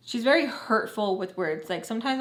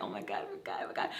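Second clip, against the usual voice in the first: frantic whispered oh my God; panicked whisper; 10 semitones higher, 11 dB quieter, much faster than usual. Retold or imitated. Retold panicked inner whisper.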